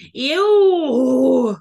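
grossed-out ew; disgusted. Real, not acted. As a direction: squeal of disgust. Disgust at parasite cysts.

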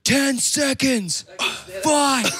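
high, slow, drawn-out countdown; mock floor manager countdown; announcing. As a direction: call out the last seconds tensely. Retelling crew countdown.

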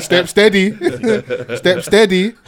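loud laughing mock Tyson; Tyson impression. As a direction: bark it through laughter. Imitating Tyson's voice.

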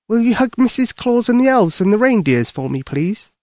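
put-on high, sweet child's request; pleading little girl. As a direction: ask a favour like a small girl, high. Voices little girl Lucy.

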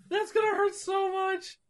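high, fading hammed-up whimper; mock crying. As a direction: trail off in fake tears. Wincing in mock tears.

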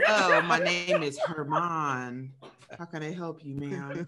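low, slow, laughing hotline greeting; mocking Herman as a hotline operator, low and soft. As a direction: put on a sleazy hotline voice. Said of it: "What if Herman worked for a sex hotline".

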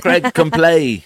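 mock a slurring Birmingham rock singer. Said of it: "I used to sound like him".

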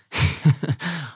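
a short real laugh. Real, not acted. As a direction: laugh fondly at the ending. Laughs at end of story.

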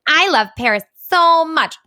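high, slow, gushing blogger parody; mocking gushy blogger. Mocking a Paris travel blogger with a gushing high voice. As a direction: lay the fake enthusiasm on thick. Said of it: "a blogger being like".

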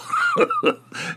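a burst of high-pitched laughter; real laugh at the mug story. Real, not acted.

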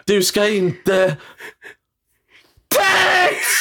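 drawn-out start then a high shout of text; hammed-up outburst; slow, then high yell. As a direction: creep in slowly, then yell. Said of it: "kommer in långsamt".

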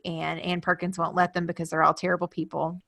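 usual speaking voice

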